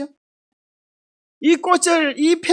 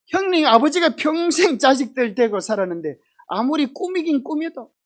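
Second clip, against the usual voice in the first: ordinary talk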